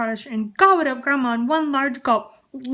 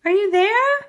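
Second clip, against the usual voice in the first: much higher, louder call; 11 semitones higher, 7 dB louder than usual. Acted. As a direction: call into the empty house. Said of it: "she called".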